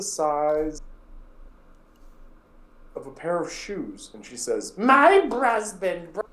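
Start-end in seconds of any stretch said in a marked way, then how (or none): 4.8-6.3 Hilda's terrible voice. Acted. Hilda introducing herself to the adventurers, high terrible voice. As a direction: drag it out like a gnarled old widow.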